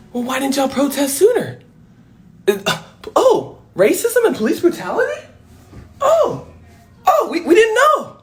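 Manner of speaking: high mock-innocent police; mock clueless police. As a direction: play dumb in a squeaky voice. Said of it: "And they're like".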